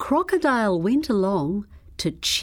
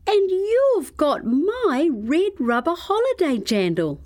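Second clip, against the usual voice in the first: much higher outcry of discovery; cried out; 9 semitones higher than usual. A narrator's reading. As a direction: cry it back at the crocodile. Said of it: "she cried".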